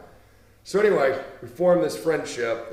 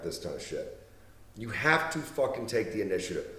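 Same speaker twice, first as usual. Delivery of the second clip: ordinary delivery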